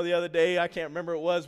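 ordinary talk

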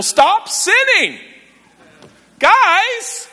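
drawn-out, high, whiny-style begging; hammed-up exasperated appeal; exasperated mock pleading. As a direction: plead with everyone, stretching each word high. Preacher parodies pleading everyone stop sinning.

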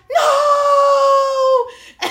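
long, high wailing no with a laugh; anguished no. Retold or imitated. Retelling the phone drop to a friend, long high wail. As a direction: wail it out in despair. Phone falls in porta potty.

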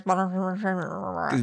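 quick throwaway aside trailing into a mumble; tossed off. Retold or imitated. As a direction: toss it off fast, then let it trail away quietly. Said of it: "kind of toss off".

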